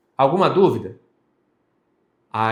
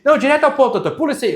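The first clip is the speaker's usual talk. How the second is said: much higher and louder, waving the lawyer on; impatient client; 11 semitones higher, 6 dB louder, much faster than usual. Retold or imitated. Client telling the lawyer to skip ahead, high and loud. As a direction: hurry him past the slide. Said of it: "igual a gente vai ver um aqui".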